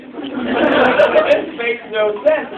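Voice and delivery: normal talking voice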